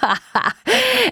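high-pitched gloating sorcerer's cackle; villain's laugh. A narrator's reading. Sorcerer gloats over the cursed princess, villainous laugh. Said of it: "Er hat gelacht und ist verschwunden".

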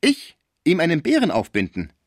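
loud, high offended echo; proud indignation. A narrator's reading. Topinard repeating the insult, proud and loud. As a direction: throw the accusation back proudly. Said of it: "sagte Topinard stolz".

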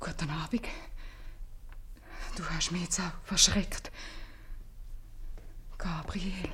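hushed, caught off guard; startled whisper. Acted. Mother startled by Gabriel, greeting him in a whisper. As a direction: whisper the greeting, still shaken.

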